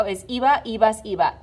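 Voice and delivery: normal talking voice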